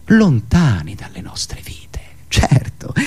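slow, whispered sarcastic aside; ironic whisper. Acted. Comedian mocking public indifference, whispered sarcastic aside. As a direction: murmur it as a sly confidence.

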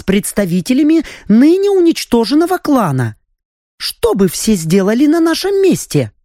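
a bright, sly countess laying out a ruse; scheming countess voice. A narrator's reading. (хитрая графиня, оживлённо)

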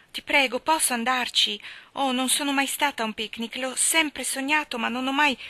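Anna begging Marilla for the picnic; eager pleading. A narrator's reading. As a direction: plead with longing.

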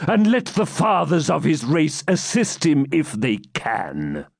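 cold mocking knight; stern knight. Baron taunting his captive, cold mockery. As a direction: sneer down at the captive.

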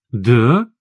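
mildly ironic, questioning; a touch of sceptical irony. A narrator's reading. Anton doubts his partner's claim, lightly ironic. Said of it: "с иронией спросил я".

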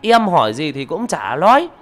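high, loud chattering account; booming gossip. Acted. Neighbour loudly telling villagers how she found him, high and loud. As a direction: tell it to the whole crowd at full volume. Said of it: "Bà ấy oang oang nói".

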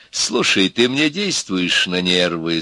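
ordinary delivery

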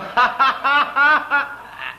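high, loud burst of laughter; mock fit of laughter; acted-out laugh. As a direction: laugh out as if cracking up. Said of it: "schoten we daar van de weeromstuit van in de lach".

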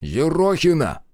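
a commander's warning bark; sharp rebuke. A narrator's reading. The commander cuts off his nosy scout with a sharp call. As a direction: cut her off by name, slow and firm. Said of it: "оборвал Марков".

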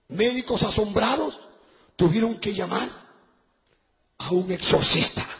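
low, measured headline read; newspaper reading. Real, not acted. Preacher reads the headline to the congregation, measured reading. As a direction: read it out deliberately, phrase by phrase.

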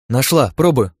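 loud, high, excited bursts; excited exclamation, pitch leaping; exclaiming. A narrator's reading. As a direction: call it out on a sudden find. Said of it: "воскликнула Лия".

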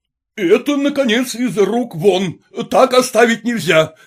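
high, emphatic outburst; indignant shouted command; outraged yell. A narrator's reading. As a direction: raise your voice in outrage. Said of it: "заорал во всё горло муж".

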